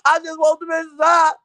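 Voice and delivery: a put-on complaining voice; mock whiny guest. Mocking the guest's complaint in a whiny voice. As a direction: whine it like the guest. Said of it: "this why she get mad now".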